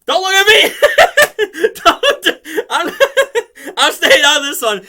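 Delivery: high, slow, laughing "don't look at me"; a giggling yellow ranger refusing to get involved; laughing protest. Retold or imitated. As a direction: squeal it out through laughter, backing away. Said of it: "she's like".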